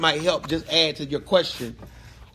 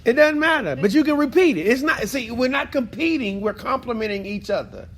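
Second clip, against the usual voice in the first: high, laughing encouragement; 8 semitones higher than usual. Real, not acted.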